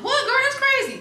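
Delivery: high-pitched incredulous reaction, imitated; mock amazement, pitch shooting way up; astonished asking. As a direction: play a friend reacting in disbelief. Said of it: "She was just asking, like".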